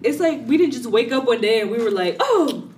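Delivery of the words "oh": pitched-up dreamy sigh; mock the starry-eyed couple; high mock gushing. Imitating a naive couple.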